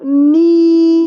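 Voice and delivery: "नी" loud, one very long held ee. Shown as a demonstration. Mother demonstrates how knee is pronounced, long loud ee. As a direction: hold the vowel of "नी" loudly as a model. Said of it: "कैसे बोलते हैं उसको".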